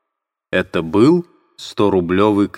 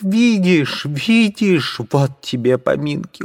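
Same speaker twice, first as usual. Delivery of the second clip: very high, furious cry; shouting at everyone; 8 semitones higher than usual. Acted. Katerina berating the onlookers, shrill furious cry. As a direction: berate the crowd.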